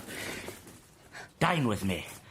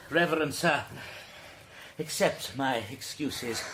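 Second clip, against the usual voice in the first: normal talking voice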